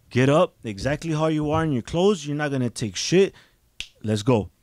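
loud, forceful retold commands; angry mother's orders. Mother ordering her child out of bed, angry and loud. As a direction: act out a mother kicking someone out.

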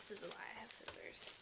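quick whispered aside; fast hushed aside to self. Real, not acted. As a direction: whisper it to yourself, hurried. Whispered aside while opening parcel.

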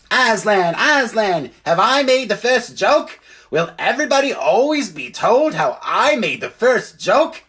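high, piping bird voice; excited jackdaw. Acted. Jackdaw clamouring to the lion about its joke, excited squawk. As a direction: squeal it proudly, pitch leaping up.

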